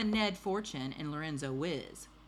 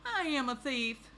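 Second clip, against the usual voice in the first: higher-pitched storybook confession; lightly voiced thief owning up; confessing thief; 8 semitones higher, 3 dB louder than usual.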